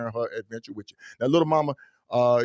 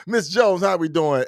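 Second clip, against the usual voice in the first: high, bright hello to Miss Jones; excited greeting; 6 semitones higher, slower than usual. Real, not acted. Host greeting Miss Jones in the chat, excited.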